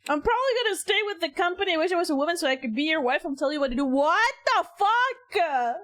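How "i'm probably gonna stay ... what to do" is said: rushed rereading. Retold or imitated. S1 rereads the quote faster.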